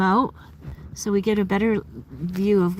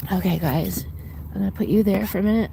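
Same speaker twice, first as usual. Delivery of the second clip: ordinary talk, nothing marked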